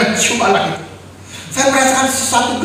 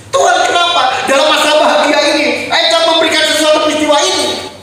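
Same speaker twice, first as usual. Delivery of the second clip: raised, anguished protest; complaining to God; 5 semitones higher than usual. Retold or imitated. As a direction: hurl the question at God. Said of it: "Saya komplain sama Tuhan". (ayah mengeluh kepada Tuhan, suara tinggi dan pilu)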